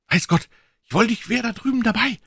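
high-pitched excited character voice; eager, high boyish outburst; exclaiming. A narrator's reading. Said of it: "rief Joe".

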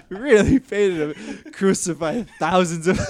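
laughing through the words; real laughter breaking in. Real, not acted. Cracking up at speech.

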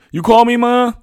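put-on high, loud boy calling to his mother; calling back. A narrator's reading. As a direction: call back loudly in a high voice.

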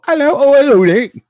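put-on high, loud English accent for the dog; English accent for a talking dog. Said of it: "My dog talks in an English accent".

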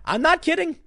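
incredulous, voice jumps up; incredulous insistence. Real, not acted. Telling viewers about news, incredulous high insistence.

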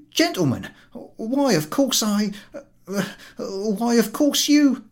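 high, loud, stammering panic; panic-stricken. Acted. Ticket collector to the two gentlemen, panic-stricken stammering. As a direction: fumble for words in fright. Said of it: "Podyakin is panic-stricken".

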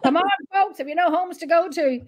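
mock closing-time plea to the punters; last-orders call. Barkeeper calling last drinks for the night, imitated call-out. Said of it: "call it out last drinks for the night".

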